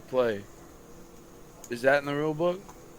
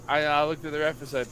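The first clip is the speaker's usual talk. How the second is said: ordinary delivery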